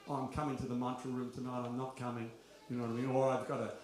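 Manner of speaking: ordinary talk, nothing marked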